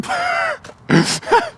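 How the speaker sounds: high-pitched real laughing fit; helpless high laugh; burst of laughter. Real, not acted. Amused by off-camera coffee prompt.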